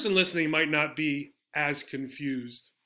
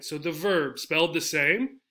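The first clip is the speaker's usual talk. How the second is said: ordinary delivery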